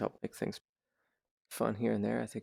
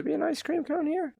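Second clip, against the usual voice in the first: surprised. Real, not acted. Puzzled at an odd object.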